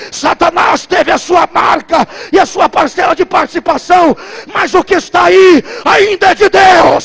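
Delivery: shouted, building preacher's cry; preaching at full force. Acted. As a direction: preach it out at the top of your voice.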